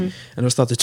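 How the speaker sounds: ordinary talk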